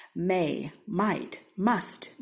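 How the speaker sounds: ordinary delivery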